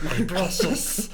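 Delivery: Gollum. Pretend. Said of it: "impression of Gollum".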